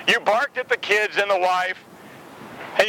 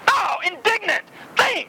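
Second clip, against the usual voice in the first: indignant; 10 semitones higher than usual. Shown as a demonstration. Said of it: "think with indignation towards me".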